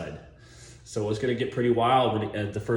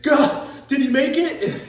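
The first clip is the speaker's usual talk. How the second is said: mock Keanu; 6 dB louder than usual. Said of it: "Keanu Reeves is like".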